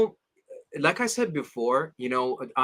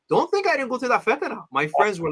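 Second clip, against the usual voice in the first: ordinary talk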